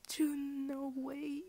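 can't believe the pull; surprised. Real, not acted. Fan pulling a rare card, excited disbelief.

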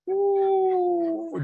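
long, high, drawn-out groaning ooh; mock groan. Real, not acted. Speaker groaning to the crowd at his own joke. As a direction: groan at the bad pun.

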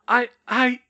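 high, loud, flustered stammer. A narrator's reading. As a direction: stammer as if lost for words. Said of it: "Molly stammered".

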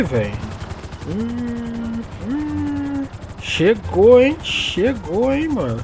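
ordinary delivery

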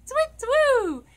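high, loud owl hoot; big swooping owl call. Pretend. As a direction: hoot like an owl, falling away. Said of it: "hoot like an owl".

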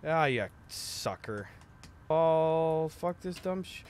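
ordinary talk, nothing marked

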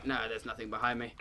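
low, quiet, quick aside; dropping back down. Real, not acted. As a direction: take it back quickly under the level of the alarm. Takes back the alarm.

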